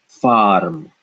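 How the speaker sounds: farm said as one syllable, r straight into m; farm, no extra vowel. Shown as a demonstration. Teacher models farm to students, one syllable. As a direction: say farm with no uh after the r. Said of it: "So this would be pronounced".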